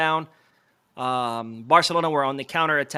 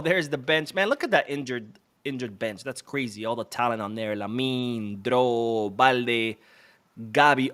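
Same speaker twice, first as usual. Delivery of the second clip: ordinary delivery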